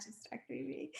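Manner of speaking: quiet playful refusal; hushed sing-song denial. Retold or imitated. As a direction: mutter it while sneaking off.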